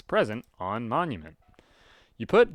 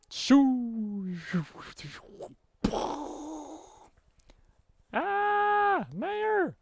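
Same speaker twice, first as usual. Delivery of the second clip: very high, squeaky cartoon voice; squeaky character voice; much slower than usual. Reader voices the shooed mayor, squeaky and high. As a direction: squeak it like a tiny alarmed creature.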